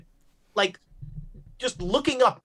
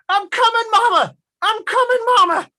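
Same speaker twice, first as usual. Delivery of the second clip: much higher, loud frantic cry; desperate shout; 14 semitones higher, 8 dB louder than usual. Acted. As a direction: call out to a lost mother. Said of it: "hears old Pete going".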